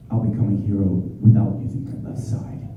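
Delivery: low, restrained character voice trailing down; Todoroki. Shown as a demonstration. Voice actor doing a requested character for fans, low and restrained. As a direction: keep it low and inward, words measured out. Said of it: "can you do your Todoroki voice".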